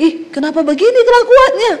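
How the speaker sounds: a quick, high, shocked "what is this?"; a wife startled by her new husband's ways; shocked disbelief. Retold or imitated. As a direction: rattle it off, high and appalled.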